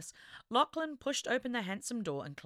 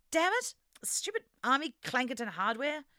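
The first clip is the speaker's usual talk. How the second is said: angry curse; 7 semitones higher, 3 dB louder than usual. Acted. Barry curses at the hardware.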